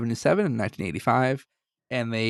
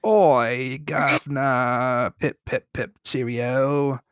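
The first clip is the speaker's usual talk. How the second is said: hammed-up British accent with drawn-out words; mock British accent; 3 dB louder, slower than usual. Giving listeners the accent they missed, in mock British. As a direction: put on a cartoonish British accent. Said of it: "didn't hear enough of the accent".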